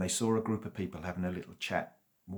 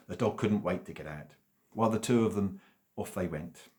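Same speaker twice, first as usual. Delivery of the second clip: plain reading voice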